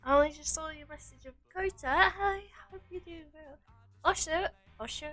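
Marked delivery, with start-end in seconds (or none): none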